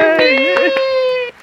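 high cheer of delight; a child's burst of joy. Acted. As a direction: shout a happy hooray. Quiz kids cheer.

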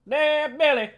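hype man. Acted. Cheering for Billy in the ad.

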